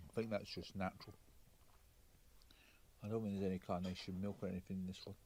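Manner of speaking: hushed talk about her smoothie. Real, not acted. At the cafe table, quietly praising the smoothie. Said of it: "Why am I whispering".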